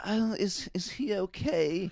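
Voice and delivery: high, fussy, worried mock voice; posh passer-by. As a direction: squeak it out like a fretting dog owner.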